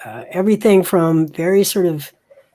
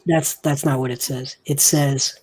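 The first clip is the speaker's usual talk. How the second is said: ordinary talk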